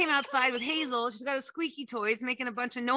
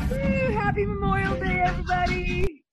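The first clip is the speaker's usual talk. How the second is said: high, loud holiday cheer; excited cheer; 8 semitones higher, 5 dB louder than usual. Acted. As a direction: shout it out festively. Party celebration.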